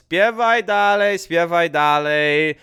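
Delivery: high, drawn-out singsong brush-off; grandfather brushing off nagging. Retold or imitated. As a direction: imitate grandpa tuning out the nagging, long vowels. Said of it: "yelling at him and nagging him".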